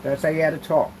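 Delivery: ordinary talk, nothing marked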